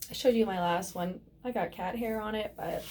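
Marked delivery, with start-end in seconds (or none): none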